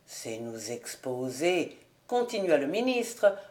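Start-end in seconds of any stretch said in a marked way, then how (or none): 0.1-1.8 very low, slow, soft admonition; hushed, ponderous sermonising; solemn minister. A narrator's reading. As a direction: intone gravely like a clergyman.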